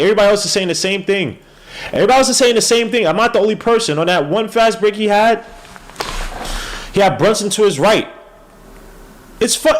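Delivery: high, loud, fast rant; real fired-up fan rant; heated rant. Real, not acted. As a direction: vent it all at the players. Heated rant about the players.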